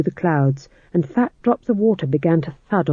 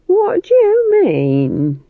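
put-on indignant old witch; 5 dB louder, much slower than usual. Granny challenges Nanny.

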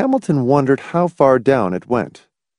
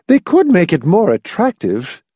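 higher-pitched mild objection; objecting; 9 semitones higher, 3 dB louder than usual. A narrator's reading. Mother criticising the power station, mild objection. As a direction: object primly. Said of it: "Missus Pritchett objected".

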